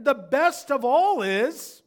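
loud, high, slow shout. Retold or imitated. Dying man lifting his hands, shouting joyfully. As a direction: raise it up like a celebration. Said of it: "even in a louder voice".

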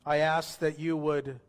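subdued prayer in short phrases, long pauses between; slow guided prayer. Real, not acted. Pastor leading new believers through a prayer, slow and subdued. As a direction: pray slowly, leaving room for others to repeat. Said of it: "I'm gonna pray slow".

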